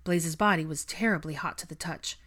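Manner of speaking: ordinary delivery, nothing marked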